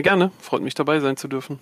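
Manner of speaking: ordinary delivery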